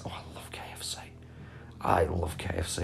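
breathy longing whisper; whispered craving. Real, not acted. Streamer whispering his love of KFC. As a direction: whisper it like a guilty pleasure.

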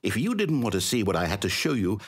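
ordinary talk

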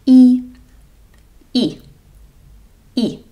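E as a clear ee sound; demonstrated vowel. Shown as a demonstration. Teacher shows the vowel і. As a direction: say E with spread lips. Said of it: "the next vowel is".

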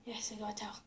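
whispered relief, shivering; relieved whisper. Real, not acted. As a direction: breathe it out, relieved. Soaked, relieved.

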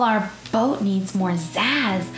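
usual speaking voice

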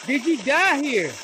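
slow, loud question to the dead; loud question to a spirit; calling out. Real, not acted. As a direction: ask the ghost slowly.

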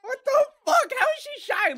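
high, loud disbelieving shout; shocked shriek. Real, not acted. Shocked reaction.

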